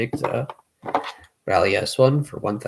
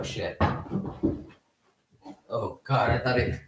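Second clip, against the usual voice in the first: ordinary talk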